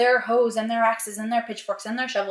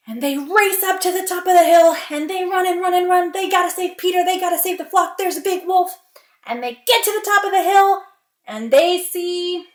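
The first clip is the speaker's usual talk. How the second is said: louder, higher, breathless-style rush of urgency; urgent narration; 6 semitones higher, 7 dB louder than usual. A narrator's reading. Villagers race uphill to save Peter, urgent. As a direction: drive the chase forward with raised voice.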